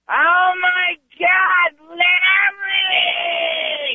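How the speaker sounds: high, drawn-out squeal of a name; mock shriek. Girlfriend opening the door to Larry at night, shrieking. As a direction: imitate a shrieking girlfriend, stretching every word.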